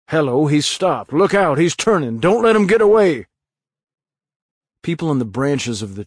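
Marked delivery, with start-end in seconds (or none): 0.1-3.3 building from alert to a high, fast, loud shout; rising alarm. Acted. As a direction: warn everyone he might run. Crowd watching the suspect.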